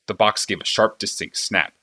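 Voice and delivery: plain reading voice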